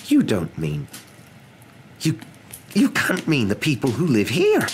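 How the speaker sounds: high, halting cry of disbelief; stammer, then cry out in alarm. A narrator's reading. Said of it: "cried Professor McGonagall".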